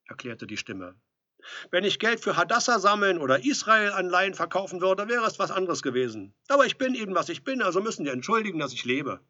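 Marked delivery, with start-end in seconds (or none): 1.7-9.2 loud, high, aggrieved rant; offended complaint. A narrator's reading. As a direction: complain loudly through the door.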